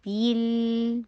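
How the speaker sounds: بل as a held "beel"; clear ee sound. Shown as a demonstration. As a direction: say بل with a long clean "ee". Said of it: "میں بی بولتی ہوں".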